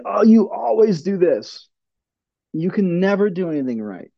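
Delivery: hammed-up nagging of someone who always fails; harsh criticism. Boss nagging an employee, mock exasperated scolding. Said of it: "It's easy to say".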